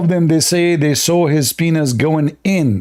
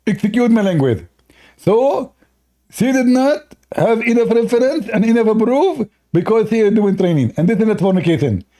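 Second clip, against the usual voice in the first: higher mock lecturer, ending in rapid no no no; Zakir Naik; 6 semitones higher than usual. Mock opponent defending the woman, higher and rising. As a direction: caricature a debater dodging. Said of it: "Question, Prince".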